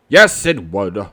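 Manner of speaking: a hint of a wise old bird; Owl's voice. Owl offering Pooh help, lightly voiced old owl.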